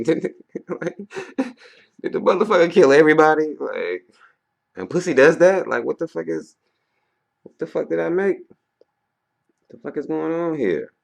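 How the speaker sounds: high, loud, incredulous mock outrage; hammed-up exasperated yelling at your own creation; pissed-off creator. Imagined creator aghast at what they made, loudly mock-pissed. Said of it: "I'd be a little bit pissed".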